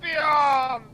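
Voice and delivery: shouted song intro. Pretend. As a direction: belt it like a ska hype man.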